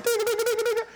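high quick digga digga noise; imitated button tapping. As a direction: mimic mashing phone keys. Imitating old phone keypad.

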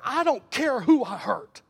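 slow, defiant voicing; preacher voicing the shooter's justification; shooter's mindset. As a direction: say it like a wounded man who no longer cares.

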